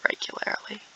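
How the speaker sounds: word dropped to a whisper. Acted. Admitting frequent crises, in a whisper. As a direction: breathe the last word.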